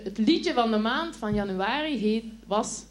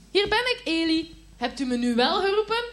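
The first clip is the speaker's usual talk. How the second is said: put-on high eager child voice; eager boy; 7 semitones higher, 4 dB louder than usual. A narrator's reading. Boy hopes Eli called him this time, eager. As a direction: ask it keenly.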